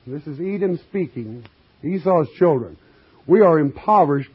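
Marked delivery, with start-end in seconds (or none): 3.3-4.3 louder, measured pulpit reading; scripture reading. Acted. Preacher reading scripture to his congregation, declaiming. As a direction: read the verse out to the flock.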